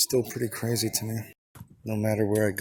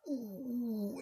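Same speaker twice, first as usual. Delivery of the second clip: high mock moan; 13 semitones higher, 5 dB quieter than usual. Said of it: "She's like".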